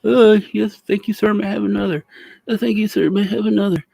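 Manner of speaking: high, fawning, bowing-and-scraping voice; mock groveling servant. Mocking a star grovelling to his bosses, high fawning voice. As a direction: grovel like a flattered lackey. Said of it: "sit there and be like".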